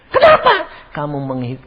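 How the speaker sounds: usual speaking voice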